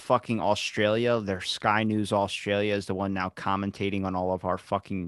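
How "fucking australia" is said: ordinary talk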